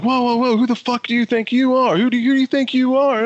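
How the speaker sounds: high-pitched indignant crowd imitation; mock outrage. Girlfriends and friends confronting the man who pushed, mock outrage.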